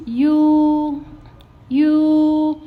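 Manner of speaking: "you" held long on a high, level pitch; flat tone, prolonged. Shown as a demonstration. Teacher starting the second part, prolonged flat tone. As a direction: sustain "you" high and loud, unmoving. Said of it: "You need to prolong it".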